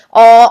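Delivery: او as a full, back aw; heavy aw sound. Shown as a demonstration. Teacher showing the vowel of saad, او thick and held. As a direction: fill the mouth on a long او. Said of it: "یہ sound آئے گی".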